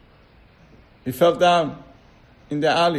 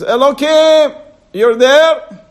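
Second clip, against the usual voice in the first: high, loud call into the void; desperate man on the cliff calling to God; 8 semitones higher, 8 dB louder, slower than usual. Acted. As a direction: shout up to the sky for help. Man hanging on tree calls out.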